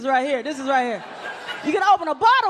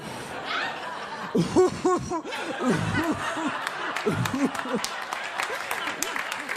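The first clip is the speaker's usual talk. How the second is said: muffled giggle behind a covering hand; laugh behind hand; 5 dB quieter than usual. Shown as a demonstration. Comic acting a toothless man hiding his laugh, muffled giggle. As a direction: laugh into your palm to hide the teeth. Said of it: "when you really gotta laugh, you always put that hand up".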